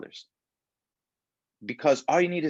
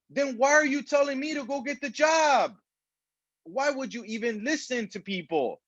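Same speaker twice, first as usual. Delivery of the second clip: exasperated outburst; 10 semitones higher than usual. Real, not acted. Exasperated retort to the friends.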